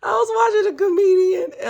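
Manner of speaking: shrieking with high laughter while starting to speak. Real, not acted. Vlogger cracking up at her own taco joke, high laughing. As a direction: laugh hard and squeal into the words.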